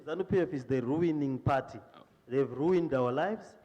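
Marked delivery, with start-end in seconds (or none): none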